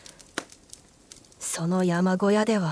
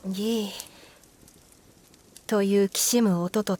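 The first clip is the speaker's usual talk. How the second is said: long drawn-out eerie creak; slow creaking sound; much slower than usual. A narrator's reading. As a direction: stretch the creak out slowly.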